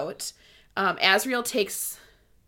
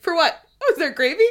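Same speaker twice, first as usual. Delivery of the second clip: higher, louder, laughing mock cluelessness; clueless Cassian; 7 semitones higher, 6 dB louder, faster than usual. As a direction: play him oblivious, laughing. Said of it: "He's like".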